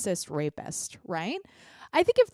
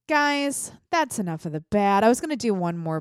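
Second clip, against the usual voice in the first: ordinary delivery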